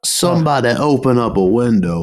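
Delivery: slow foppish Southern drawl; Southern dandy voice. Retold or imitated. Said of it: "be a Southern dandy".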